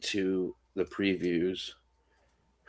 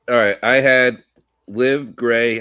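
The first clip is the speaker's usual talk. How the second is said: ordinary talk, nothing marked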